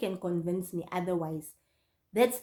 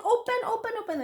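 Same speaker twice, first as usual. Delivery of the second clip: high, repeated acted plea; re-enacted desperate begging; urgent panicked call; 12 semitones higher, faster than usual. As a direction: pester in mock panic to be let in. Fleeing, begs friend to open gate.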